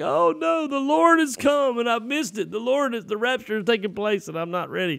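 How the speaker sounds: high-pitched mock panic; hammed-up wail of a panicked boy; freaking out. Boy who thinks he missed the rapture, freaking out. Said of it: "I started freaking out".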